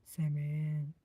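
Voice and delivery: low, put-on deep voice. Shown as a demonstration. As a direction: drop down low, playfully. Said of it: "in your deep voice".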